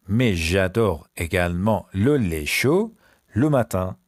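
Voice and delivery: very slow French in separated chunks; slow repeat. Shown as a demonstration. Teacher repeats the hot milk sentence, very slowly. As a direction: pause between word groups, dictation-slow.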